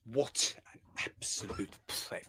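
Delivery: "What an absolute pleb" whispered slowly, with no voice, full of scorn; contemptuous whisper. Real, not acted. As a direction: breathe the insult out slowly under your breath. Contemptuous whisper after the quote.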